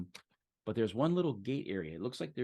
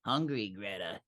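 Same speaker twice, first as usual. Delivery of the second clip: a hint of a creepy chanting voice; second voice. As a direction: repeat it like a hungry refrain. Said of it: "the other voice go".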